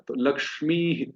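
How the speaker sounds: usual speaking voice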